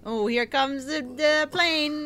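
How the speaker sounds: put-on baby feeding voice; airplane spoon game. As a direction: here comes the plane, to a baby. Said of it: "like a little baby".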